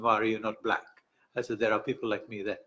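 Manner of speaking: usual speaking voice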